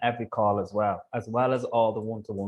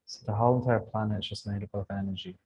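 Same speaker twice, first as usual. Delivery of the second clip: guided meditation. Acted. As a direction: gently guide them through visualization.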